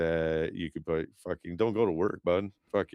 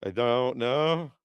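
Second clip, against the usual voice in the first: higher and slow, dazed; slow, blank, higher-pitched; mock clueless answer; 7 semitones higher, 5 dB louder, slower than usual. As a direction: sound lost when asked who you are. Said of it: "I'm like".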